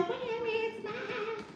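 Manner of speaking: very high, slow whiny wail; mock crying high plea; Leonardo DiCaprio impression. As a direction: stretch it out high and slow like a spoiled man. Repeating DiCaprio line.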